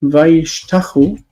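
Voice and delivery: slow "Vayishtachu", stressed on "tach", ending in unstressed u; "Vayishtachu" said slowly, weight on "tach"; stress before final u. Shown as a demonstration. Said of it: "the stress is maintained there".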